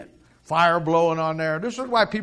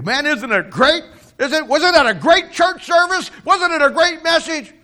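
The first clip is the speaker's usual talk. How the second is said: much higher and louder, gushing; excited churchgoer; 13 semitones higher, 9 dB louder than usual. Acted. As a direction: bubble over about the sermon. Excited churchgoer talks to Peter.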